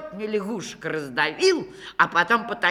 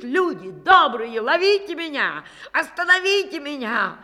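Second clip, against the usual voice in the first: loud, higher cry for help; frightened yell; 6 semitones higher, 6 dB louder than usual. A narrator's reading. Akim yelling to the villagers in a panic. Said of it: "кричит".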